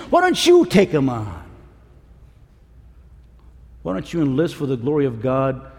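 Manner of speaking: ordinary delivery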